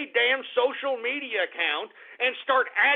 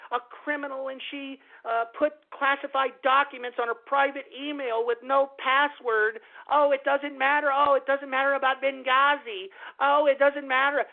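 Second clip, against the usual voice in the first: higher, gushing mock-enthusiasm all through; mocking media gush; 4 semitones higher than usual. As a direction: parody breathless praise you despise. Mocking media praise of Hillary.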